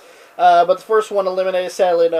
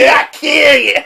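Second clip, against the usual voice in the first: raised, hammed-up yell; echoing the Sid yell; mock shout; 6 semitones higher, 5 dB louder than usual. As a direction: bark the threat back for fun.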